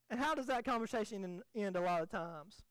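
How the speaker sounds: normal talking voice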